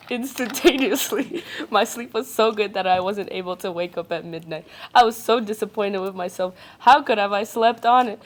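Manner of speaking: usual speaking voice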